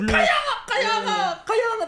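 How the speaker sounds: very high, laughing insistence; excited agreement. Real, not acted. Answering friends who ask what happened, excitedly agreeing. Eagerly agreeing with puzzled listeners.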